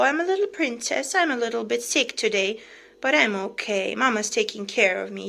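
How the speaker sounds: loud, playful voice for a pet; speaking for pet. Pretend. Owner answering viewers as her sick little princess, playful pet voice. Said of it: "Say hello".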